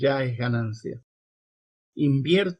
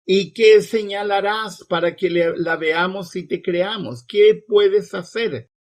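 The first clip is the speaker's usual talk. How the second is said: mildly insistent, raised and pressing questions; insistent demand; 5 semitones higher, 6 dB louder than usual. A narrator's reading. Crowd challenging Jesus for a sign, insistent. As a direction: press for proof with a louder last question. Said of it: "insistieron ellos".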